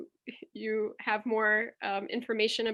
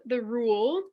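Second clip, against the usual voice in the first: ordinary talk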